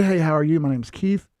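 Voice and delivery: a hint of a smooth interviewee; polished candidate voice. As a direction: sound like you know the job. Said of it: "But if I go in there and say".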